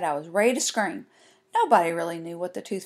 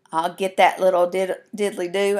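usual speaking voice

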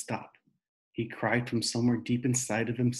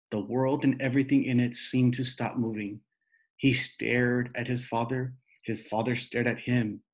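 ordinary delivery, nothing marked

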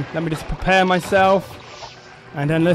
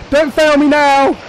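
excited panic; 9 semitones higher, 5 dB louder than usual. Real, not acted. Panicked play.

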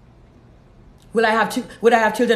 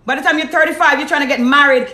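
ordinary talk, nothing marked

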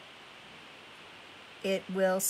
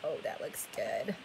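breathy whisper to herself; 7 semitones lower, 6 dB quieter than usual. Real, not acted. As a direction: murmur it under your breath. Quiet aside while stamping.

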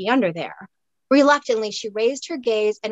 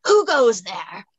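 slow, hoarse Highland challenge; Scottish burr; 3 dB louder, slower than usual. Acted. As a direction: call out from behind the wall in a rough Scots burr. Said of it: "A hoarse Scottish burr rolled".

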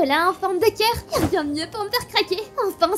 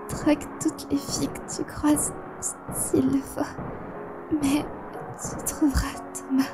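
weak dying whisper; 3 semitones lower, 6 dB quieter than usual. Acted. Dying woman's last words.

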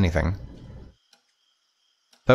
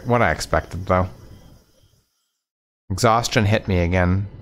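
usual speaking voice

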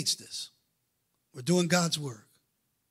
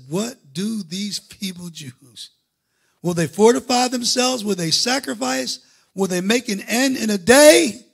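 slow, scornful taunt rising higher and louder; mocking Sanballat; 8 semitones higher, 11 dB louder, slower than usual. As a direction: taunt the builders with contempt.

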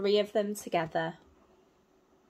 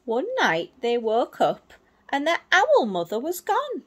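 ordinary delivery

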